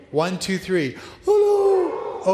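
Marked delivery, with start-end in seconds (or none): 1.3-2.3 high, loud frightened Oh no; mock fright. Shown as a demonstration. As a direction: squeal it like a scared crowd. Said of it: "Can you act really afraid?".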